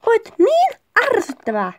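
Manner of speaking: high, exasperated acting; annoyed quarrel. Partner denying having started it, annoyed. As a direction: play it irritated, pitch jumping up. Acted quarrel, blaming each other.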